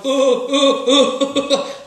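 loud, high, laughing yelps; hammed-up flustered cook; mock panic. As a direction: yelp like the egg is getting away from you. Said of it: "and then you go".

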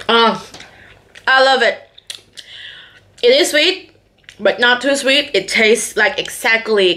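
chewing while talking; muffled by food; mouth full. Real, not acted. Seen 0: Bites the cookie stick at 0.4, then chews with mouth closed.

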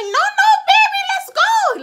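put-on high, loud Latin-accented shout; urgent crossing guard shout. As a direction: yell a frantic warning to a child. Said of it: "She had the Latin accent".

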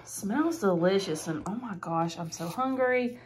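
ordinary talk, nothing marked

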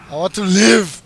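normal talking voice

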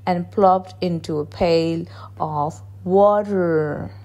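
plain reading voice

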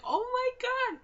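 very high, loud squeal; re-enacted high-pitched scream; excited scream. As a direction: shriek it in delighted disbelief. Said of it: "I was like".

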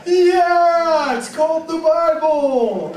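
loud, high, drawn-out shout; mock-astonished full-voice yell; exuberant holler. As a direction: holler it slowly over laughter. Mock-shouting back at amazed crowd.

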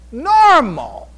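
normal hit high and loud, then falling away; stressed word. Real, not acted. Preacher telling the congregation a great man should be normal, high and loud.

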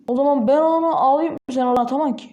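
usual speaking voice

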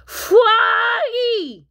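high, loud crying call; bawling, babyish cry for brother; baby's wail. Acted. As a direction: wail the name like a baby. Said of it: "wailed Polly".

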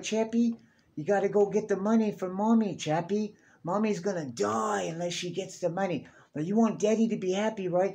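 high, coaxing put-on voice of a scheming parent; manipulative parent voice. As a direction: sweet-talk the robot into doing your bidding. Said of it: "they was like".

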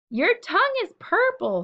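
high, loud playful scream. A narrator's reading. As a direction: shriek it across the barn. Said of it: "screamed Fern".

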